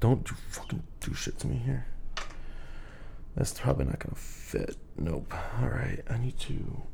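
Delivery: hushed whisper to self. Real, not acted. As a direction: whisper it under your breath. Whispering to himself while fixing setup.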